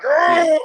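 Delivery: loud, drawn-out roar of a fired-up player; mock battle yell; imitated pumped-up roar. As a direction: roar like you've just been slapped awake. Imitates pumped-up player after slap.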